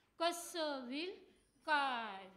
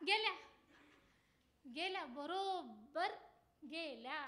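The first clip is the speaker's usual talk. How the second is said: ordinary talk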